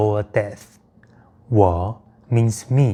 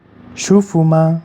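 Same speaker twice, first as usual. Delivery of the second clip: moaned question; 7 semitones higher, 7 dB louder than usual. Shown as a demonstration. Teacher acts partner's moaned question.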